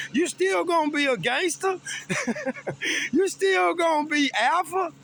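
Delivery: high, laughing mock challenge; a laughing, high-pitched jab at a tough brother; teasing laugh. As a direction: poke fun at him through a laugh. Teasing brother about staying gangster.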